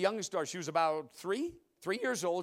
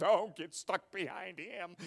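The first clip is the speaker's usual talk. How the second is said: grumbling drivers; slower than usual. Retold or imitated. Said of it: "everybody behind me says".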